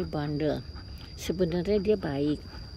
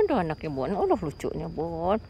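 ordinary talk

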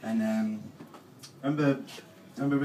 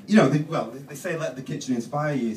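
normal talking voice

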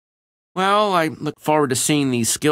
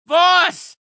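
shout; 6 dB louder than usual. Acted. Voss in danger.

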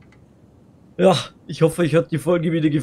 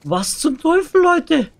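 high, loud "Was zum Teufel" outburst; loud disbelief; 14 semitones higher, 8 dB louder, slower than usual. Real, not acted. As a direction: yell it in total disbelief. Streamer echoes the line loudly. (laut und fassungslos)

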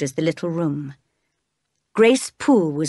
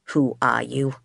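low growl at the stranger; a low, menacing demand; 5 semitones lower than usual. A narrator's reading. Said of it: "he growled at the stranger".

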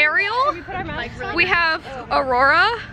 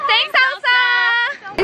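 higher, drawn-out group chant; cheering thanks; 5 semitones higher, much slower than usual. Shown as a demonstration. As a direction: sing out a grateful cheer, stretched slowly. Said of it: "So everyone say".

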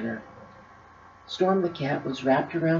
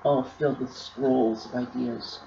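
usual speaking voice

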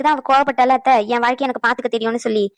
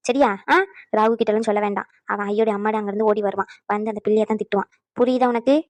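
normal talking voice

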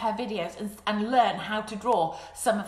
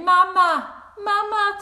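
put-on high, loud baby calling; baby crocodile; 10 semitones higher, 9 dB louder, slower than usual. Baby crocodile calls the duck, high loud Mama. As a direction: call out for mummy. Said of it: "And he's saying".

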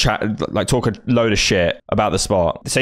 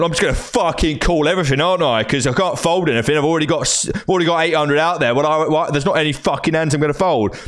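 high-pitched mock indignation; hammed-up aggrieved complaining; mimicked exasperated rant; 5 semitones higher than usual. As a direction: grumble like a tilted poker player. Mimics angry opponent's rant.

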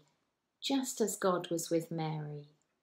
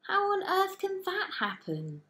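incredulous cry; 12 semitones higher than usual. A narrator's reading. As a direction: cry out as if it can't be true. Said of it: "Mary cried".

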